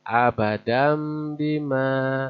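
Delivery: "Abadam bima" slow, tanwin read as m; iqlab reading. Shown as a demonstration. Teacher models the tanwin example for students. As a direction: recite "Abadam bima" slowly. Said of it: "maka dibacanya mim".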